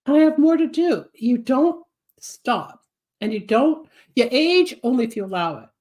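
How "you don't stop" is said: ordinary talk, nothing marked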